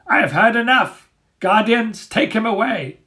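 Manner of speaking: high, loud dog leader giving orders; Dagger commanding. A narrator's reading. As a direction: bark the order at the guardians.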